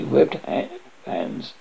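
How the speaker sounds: ordinary delivery, nothing marked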